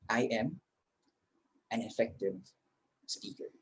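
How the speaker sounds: a calm quiet self-affirmation. Shown as a demonstration. (mahinahong pagsasabi sa sarili)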